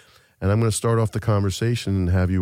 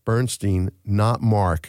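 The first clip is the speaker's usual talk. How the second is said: ordinary talk, nothing marked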